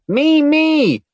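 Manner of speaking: high, loud eager cry; bursting to be picked; excited girl. A narrator's reading. Little girl begging the man to pick her, excited. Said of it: "dancing in place excitedly".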